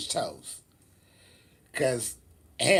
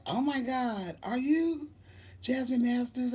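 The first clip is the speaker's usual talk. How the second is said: much higher, softer, breathless fan; starstruck fan; 9 semitones higher, 5 dB quieter than usual. Retold or imitated. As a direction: gasp it out like a starstruck girl. Fan girl recognizing her.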